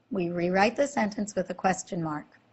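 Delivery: normal talking voice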